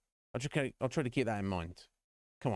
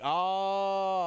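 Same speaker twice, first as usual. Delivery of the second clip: a high, loud oh; surprised cry; 10 semitones higher, 5 dB louder than usual. Real, not acted. Reacting with a sudden high cry.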